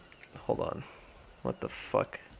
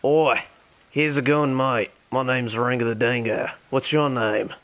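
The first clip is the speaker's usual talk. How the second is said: terrible mock Australian accent; Ringo the Dingo. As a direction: chat along in a badly faked Australian accent. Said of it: "my terrible Australian accent".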